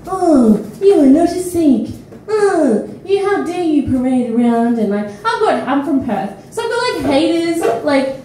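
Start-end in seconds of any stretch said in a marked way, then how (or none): 0.1-4.8 louder, scolding indignation; hammed-up how-dare-you scolding; mock outrage. Angry online haters berating the performer, louder and indignant. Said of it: "they say things like".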